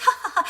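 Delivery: light affectionate mock chuckle; loving laugh. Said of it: "lovingly, like, laughs and goes".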